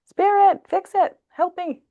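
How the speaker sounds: hammed-up frantic cry to Spirit; panicked scream. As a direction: shriek like you're fleeing in terror. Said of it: "run away screaming".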